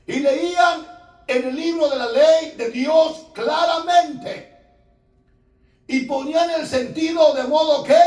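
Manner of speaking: loud, high preaching to the congregation; loud high sermon voice, reading scripture aloud; preaching at full force. Acted. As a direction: proclaim it like a fiery preacher. Preaching from Nehemiah.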